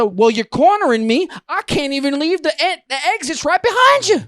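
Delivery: high, climbing exasperation; retold frantic complaint; cornered protest. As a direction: argue back with rising outrage. Retold argument, being cornered.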